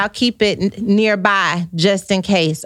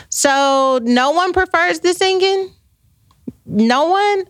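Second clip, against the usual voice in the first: mock dismay; 7 semitones higher, slower than usual. Real, not acted. Mock disappointment about singing.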